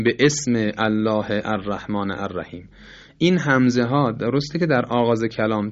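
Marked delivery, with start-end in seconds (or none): 0.0-2.7 به اسم الله الرحمن الرحیم word by word, each opening vowel pronounced; every hamza sounded. Shown as a demonstration. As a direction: separate به from اسم and give each ال its own glottal onset. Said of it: "نمیگیم".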